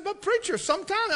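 high-pitched "But preacher" protest; high protesting student. Retold or imitated. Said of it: "He said".

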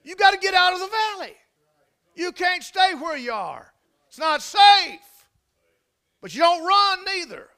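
high, loud, urgent exhortation; full-force preaching. Real, not acted. Preacher urging the congregation out of the valley, full force.

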